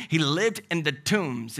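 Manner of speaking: slow, deliberate He lived in the tombs, weight on lived; slow emphasis. Real, not acted. Repeated phrase, slowed down.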